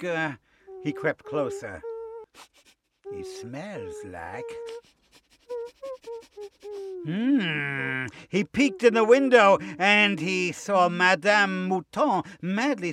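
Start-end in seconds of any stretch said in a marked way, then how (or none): 3.1-8.1 soft, slow wolf musing; wolf savouring. Acted. Wolf creeps closer to the sheep, savouring. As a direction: trail into a hungry hum. Wolf sniffing out his prey.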